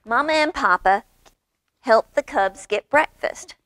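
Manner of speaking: usual speaking voice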